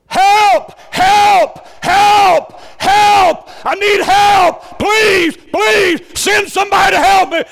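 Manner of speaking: very high, very loud panicked screaming for help; desperate cry for help. Retold or imitated. Frantic caller begging the operator for help, screaming. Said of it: "They start saying something like this".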